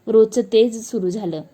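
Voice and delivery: ordinary delivery, nothing marked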